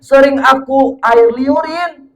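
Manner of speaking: suara tinggi sambil tersedu-sedu; menangis tersedu. Acted. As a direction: meratap haru sambil menangis. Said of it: "nangis, terseduh-seduh". (menangis tersedu-sedu karena terharu)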